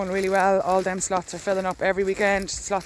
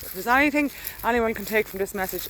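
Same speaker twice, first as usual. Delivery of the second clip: normal talking voice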